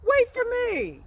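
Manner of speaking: high, loud call of hey, wait for me. Shown as a demonstration. Teacher calls out the example to the class, loudly. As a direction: call after friends running ahead. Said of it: "the interjection is hey".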